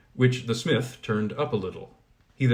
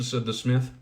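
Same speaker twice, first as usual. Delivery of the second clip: ordinary delivery, nothing marked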